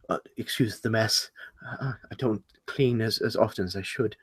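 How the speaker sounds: soft, hesitant excuse; sheepish apology. Acted. Host apologising for mouse in glass, sheepish. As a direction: apologise quietly, slightly embarrassed.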